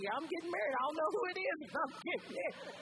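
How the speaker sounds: high, loud, giddy reply, acted out; excited announcement. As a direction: crow it back to the excited ladies. Acting out her excited reply.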